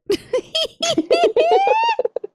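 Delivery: high-pitched fangirl cackle; excited squealing laugh. Real, not acted. Fangirl reaction to Rhea's music.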